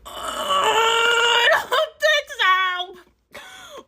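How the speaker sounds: very slow, high, loud no way; drawn-out loud refusal. Real, not acted. Rejecting the date's mother comment, slow loud refusal. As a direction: stretch it out and shout it.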